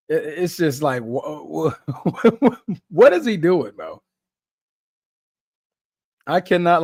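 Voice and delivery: ordinary talk, nothing marked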